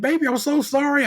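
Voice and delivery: high sweet imitation of mother; voicing mom. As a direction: play mom saying sorry to her child. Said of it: "And she's like".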